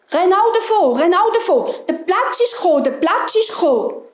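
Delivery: high, urgent warning cries; animals calling alarm. A narrator's reading. Animals warn the sitting fox, high alarmed calling. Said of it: "die Tiere haben gerufen".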